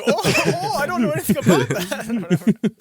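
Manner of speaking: high, slow mock German accent with a laugh; hammy German accent, playing innocent. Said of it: "that was my German accent".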